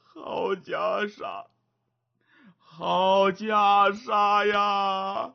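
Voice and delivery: put-on slow, awed old monk voice; slow reverent murmur of an old monk; old monk marveling. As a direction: linger over each word, lost in greed. Old monk covets the robe.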